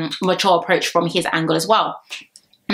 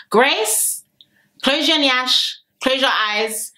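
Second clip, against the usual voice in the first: playful call-out; 4 semitones higher, much slower than usual. Pretend. Calling out to Grace playfully.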